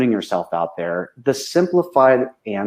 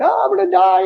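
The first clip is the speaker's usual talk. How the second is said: a much higher, panicked shriek of fear; panicked client; 3 dB louder than usual. Retold or imitated. Healing client in a panic, high mock shriek. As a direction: mimic a freaked-out client sure they're dying. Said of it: "freaked out and they're like".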